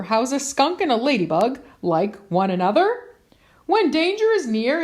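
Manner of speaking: ordinary delivery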